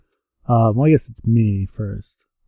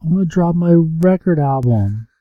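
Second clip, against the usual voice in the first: put-on young girl voice; 7 semitones higher than usual. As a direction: lightly play a drugged-up kid. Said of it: "She's like".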